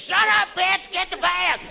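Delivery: put-on very high kid yell; panicked kid. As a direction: shriek it high like a frantic child. Kid yelling at mother.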